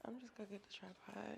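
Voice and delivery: hushed unvoiced whisper. Real, not acted. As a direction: whisper it to yourself. Whispered aside.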